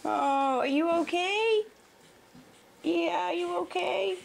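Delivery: high, sing-song concern; doting, worried puppy talk. Real, not acted. Cooing at puppy.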